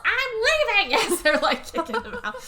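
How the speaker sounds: high, loud parting shout; man being kicked out of the bank, mock indignant yell. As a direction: storm out yelling it. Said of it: "like, yells".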